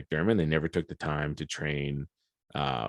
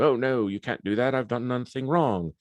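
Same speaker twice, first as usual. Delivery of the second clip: high, mock-innocent Palpatine voice, pitched up; playing innocent; 7 semitones higher than usual. Palpatine greets the arresting Jedi, acting falsely innocent. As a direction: feign surprise like an innocent old man. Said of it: "So he kind of plays innocent".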